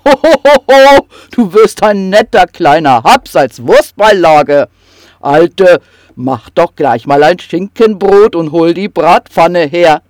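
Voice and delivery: loud, coarse troll voice; gleeful troll. Acted. Troll calls to his wife to fetch the pan, loud and gleeful. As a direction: relish the meal out loud. Troll plans to eat kobold.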